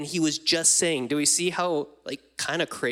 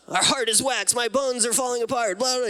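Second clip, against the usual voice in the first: higher, ironic sing-song complaint; mock worship lyric; 8 semitones higher than usual. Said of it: "worship songs were like that".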